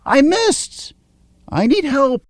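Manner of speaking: high, squealing panic; panicked. A narrator's reading. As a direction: cry out for help in a fright. Jess panics, spell misses.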